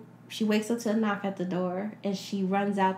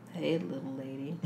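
creepy delivery guy; 5 semitones lower, 9 dB quieter than usual. Retold or imitated. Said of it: "This creepy dude".

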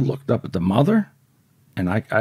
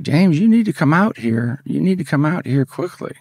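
higher and louder, calling him to the door; urgent call; 7 semitones higher, 5 dB louder than usual. Retold or imitated. Wife calling her husband to come out, urgent and louder. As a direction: call out with alarm in the voice.

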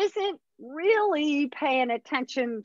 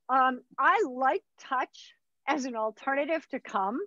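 ordinary delivery

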